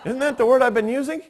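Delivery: high-pitched, quick, mock-indignant question; retold exasperation. Son answering his dad who finally understood, mock-exasperated. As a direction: protest comically that you said exactly that.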